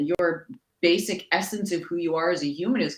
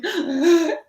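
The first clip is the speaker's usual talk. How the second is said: high, sharp acted gasp; theatrical high intake of breath; 11 semitones higher, 4 dB louder than usual. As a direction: gasp as if caught out. Reenacts reply after the screaming.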